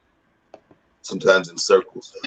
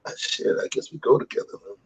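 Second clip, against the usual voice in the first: resigned inner voice; 6 dB quieter than usual. Retold or imitated. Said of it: "it's like".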